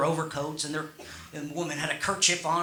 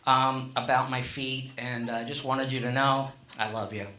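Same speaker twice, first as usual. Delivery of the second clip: apologetic; 5 semitones lower than usual. Retold or imitated. Husband to wife under the covers, tender apology. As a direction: lower the voice into a tender apology, acted. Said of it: "I felt terrible".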